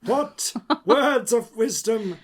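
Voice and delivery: slow, high, booming proclamation; grand oracle voice. Pretend. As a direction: intone it like an ancient seer. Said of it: "Who is this person?".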